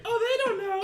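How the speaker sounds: tiny high-pitched creature squeak; small voice. Acted. Game master voices an unseen creature behind the players, small voice. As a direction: pipe up very high, like something little. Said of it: "You'll hear a small voice from behind you go".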